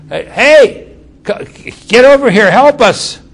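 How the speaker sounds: high, loud holler across the water; calling for help. Retold or imitated. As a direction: shout to your partners in the other boat. Fishermen calling to other boat.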